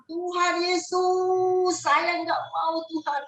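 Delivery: high, long-drawn wail to Jesus; retold cry out. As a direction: stretch the words in a pleading call. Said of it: "saya teriak".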